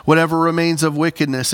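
normal talking voice

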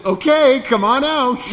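high, loud, giggly mock invitation; a ghost gleefully luring Peter out onto the water; laughing ghost. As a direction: cackle the invitation like a sly ghost. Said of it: "and the ghost says".

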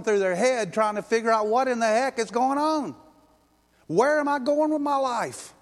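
climbing from strong to shouting; preaching at full force. Real, not acted. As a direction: build the sermon to a peak. Preacher building to climax.

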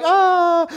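high, held, loud "ahh"; doting "ahh". Retold or imitated. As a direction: imitate a mother cooing over her child. Imitates mom doting on child.

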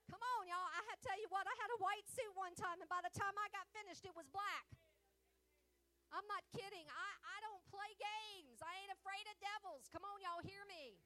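loud, high, fervent preaching; a high, loud call to the congregation; full-force preaching. Acted.

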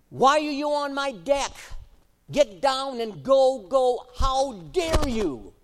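loud, high, slowed commanding scold; teacher voice of authority. Retold or imitated. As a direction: order the bear off like an angry teacher. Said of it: "her teacher voice of authority".

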